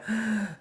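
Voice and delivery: sharp acted intake of breath; gasp. Breathing before answering a rude man, acted gasp. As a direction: gasp to steady yourself, retold. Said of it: "but I breathed, went".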